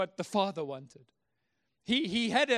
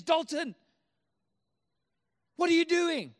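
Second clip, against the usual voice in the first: higher, raised voice calling after a runaway son; acted-out parent's shout down the driveway; dad calling out; 6 semitones higher, 3 dB louder than usual. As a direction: call out like a baffled dad.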